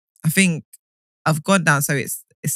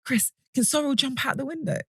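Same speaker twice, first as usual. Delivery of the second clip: worried mum; 9 semitones higher, 7 dB quieter than usual. Retold or imitated. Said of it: "she's like".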